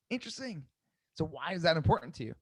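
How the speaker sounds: INTERESTING very soft and high, then WHY asked gently; hushed, intrigued INTERESTING, easing down into a soft IMPORTANT TO YOU; curious tonality. Shown as a demonstration. Coach showing how to ask a client why, curious tonality. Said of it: "you're using your tonality".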